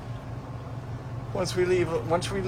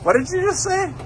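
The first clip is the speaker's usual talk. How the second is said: mock indignant. Mock outrage at the joke.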